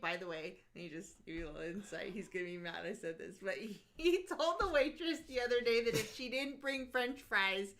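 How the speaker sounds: usual speaking voice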